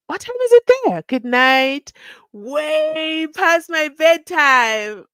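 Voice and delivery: loud, high, drawn-out exclamations; exclaiming. Real, not acted. Host exclaims at a viewer's late hour, calling out. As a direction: call it out big, stretching the vowels.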